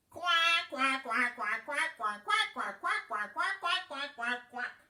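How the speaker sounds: loud run of repeated quacks; duck quacking. A narrator's reading. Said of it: "said the duck".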